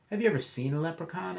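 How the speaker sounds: put-on high, fast curious kid; eager child question. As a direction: ask like a wide-eyed child.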